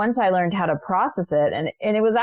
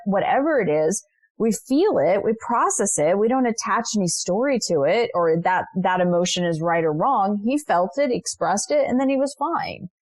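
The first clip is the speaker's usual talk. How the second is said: ordinary talk, nothing marked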